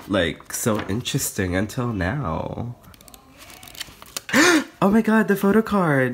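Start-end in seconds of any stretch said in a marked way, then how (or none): 4.2-4.8 loud high excited gasp; sharp gasp. Real, not acted. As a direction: gasp in delight. Sees the photo card.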